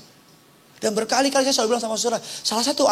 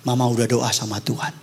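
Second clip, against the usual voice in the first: mother reassuring; 12 semitones lower, 4 dB quieter than usual. Retold or imitated. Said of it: "Terakhir dia bilang gini sama saya".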